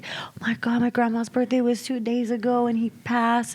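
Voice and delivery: retold whispered shock; emotional whisper. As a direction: gasp it out under your breath, shaken. Friend moved by a reading.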